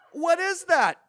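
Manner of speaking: high, loud sharp question; demanding. Retold or imitated. Mother grills her crying child, loud and demanding. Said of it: "she demanded".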